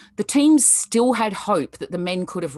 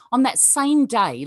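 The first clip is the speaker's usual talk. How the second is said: normal talking voice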